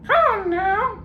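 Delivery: very high parrot echo; mock him back like a parrot; parrot mimicking.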